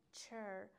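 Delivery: چر drawn out slowly, starting with "ch"; "ch" sound in چر. Shown as a demonstration. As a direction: model چر with a crisp "ch" onset.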